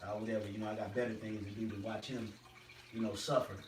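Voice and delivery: low, quiet shrug of a voice; indifferent brush-off. Acted. Speaker voicing an imagined uncaring Lord, dismissive.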